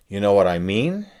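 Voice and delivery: "mean" loud and rising; a loud, questioning "mean"; stress on mean. Shown as a demonstration. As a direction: lift the word "mean" hard. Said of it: "I change the meaning subtly by changing the emphasis".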